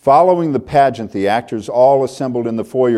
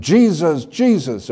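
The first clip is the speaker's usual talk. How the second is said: calling out; 6 semitones higher, slower than usual. Retold or imitated. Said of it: "the blind beggar screaming out in reckless abandon".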